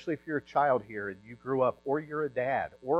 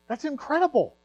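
amazed exclamation; 7 dB louder than usual. Real, not acted. Marvelling at father's story.

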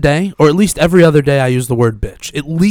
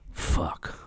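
whispered gravelly curse; breathy Witcher-style fuck; Witcher growl; 15 dB quieter than usual. Retold or imitated. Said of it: "the Witcher fuck".

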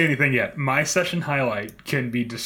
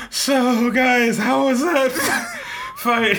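laughing; 9 semitones higher, slower than usual. Retold or imitated. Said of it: "he's like".

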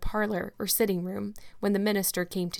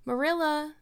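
higher plaintive call over the banisters; 8 semitones higher than usual. A narrator's reading. Anne calls down to Marilla, ready to repent, plaintive and higher. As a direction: call down the stairs, pleading a little. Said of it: "a plaintive voice calling".

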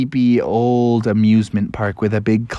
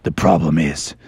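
low Batman voice; 8 semitones lower than usual. Acted.

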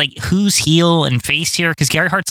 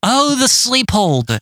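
slow, higher-pitched mock commentator call; imitating wrestling announcer; 8 semitones higher, slower than usual. Co-host mimics the announcer's habit of saying sleep hold, mock announcer. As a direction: draw it out like an announcer's catchphrase. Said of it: "I've heard him say this".

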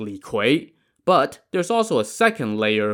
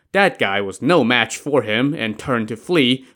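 plain reading voice

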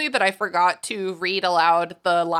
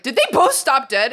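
loud, raised disbelieving question; incredulous; 6 semitones higher, 6 dB louder than usual. Retold or imitated. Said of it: "and I was like".

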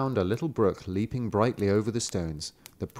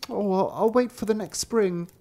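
put-on higher little-boy voice, reluctant; brother's voice; 10 semitones higher than usual. A narrator's reading. Thirsty brother putting off his drink, high and reluctant. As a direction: give in grudgingly. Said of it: "but said".

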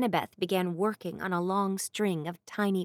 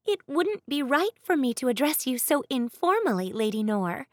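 put-on higher, polite young maid; maid's voice; 5 semitones higher, 3 dB louder than usual. A narrator's reading. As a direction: deferential servant girl voice. Maid Annabeth voiced in story.

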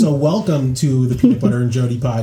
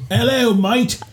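higher-pitched put-on Australian greeting; mock Australian accent; 9 semitones higher than usual. Host greets overseas listeners from the UK, mock Australian accent. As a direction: call out in a playful Australian accent. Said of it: "Oh, is that Australian?".

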